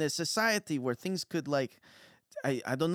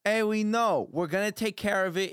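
higher-pitched, put-upon worker; exasperated reassurance; 7 semitones higher, 4 dB louder than usual. Acted. Delivery worker answering a worried customer, exasperated. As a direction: brush off a fussing customer. Game master's worker brushes off warning.

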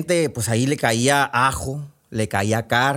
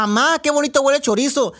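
much higher, louder call to mom; sleep shouting girl; 13 semitones higher, 4 dB louder than usual. Acted. Sleeping girl calling to her mom about chorizo, loud. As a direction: yell it out in her sleep, dreamy and greedy. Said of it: "Y gritaba".

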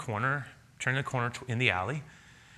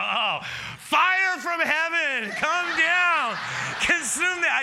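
much higher, louder, slow hammed-up curse; mock furious shout; 5 dB louder, much slower than usual. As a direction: call down fire like an angry prophet. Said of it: "I go".

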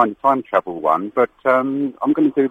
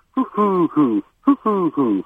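high hooting pigeon coo, falling away; London pigeon coo; 7 semitones higher, much slower than usual. Retold or imitated. Caller imitates London pigeons for the host, high hoots. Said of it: "they always seem to go".

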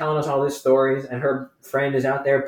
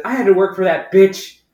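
higher and louder, slurring tipsy rant; drunk woman impression; 5 semitones higher, 7 dB louder than usual. Drunk customer complaining about her old boss, loud tipsy rant. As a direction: lurch through it like a drunk ranting. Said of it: "She just said".

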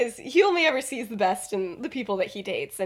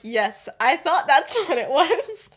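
slow, laughing, much higher; 7 semitones higher, 8 dB louder, slower than usual. Retold or imitated. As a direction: crack up mid-line. Remus quote, laughing.

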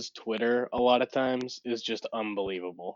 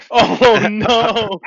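laughing, loud and high; a loud laughing groan; 8 semitones higher, 12 dB louder than usual. Real, not acted. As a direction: burst out laughing in shock. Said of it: "Damn. That's brutal".

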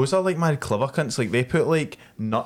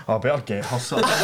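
imagined crow; faster than usual. Retold or imitated. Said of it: "as if the crows were going".

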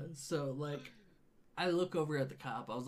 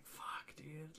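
under-the-breath whispered curse; whispered panic; 13 dB quieter than usual. Retold or imitated. Panicking beside his friend's hospital bed, whispered curse. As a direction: hiss it so nobody hears.

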